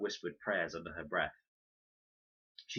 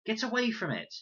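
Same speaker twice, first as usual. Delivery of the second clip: high, urgent shout; girl shouting at a vulture; 5 dB louder than usual. A narrator's reading. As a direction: drive the birds off. Chasing vultures off.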